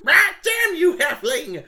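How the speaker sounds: high squawking bird-man shout. A narrator's reading. Wounded Bixie squawking at the halfling. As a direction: squawk it out in pain. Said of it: "he squawks".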